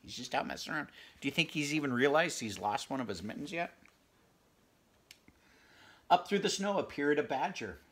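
ordinary delivery

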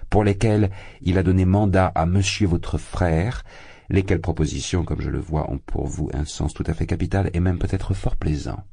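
ordinary delivery, nothing marked

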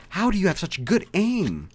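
high, loud complaint; exasperated. Real, not acted. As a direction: cry out in disbelief at the enemy. Exasperated at enemy hitting him.

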